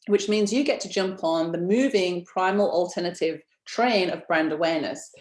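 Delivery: ordinary delivery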